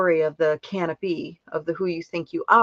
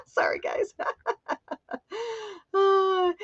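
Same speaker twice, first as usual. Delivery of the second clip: giggly apology; 14 semitones higher, 4 dB quieter, slower than usual. Real, not acted. Host apologizing after misclick.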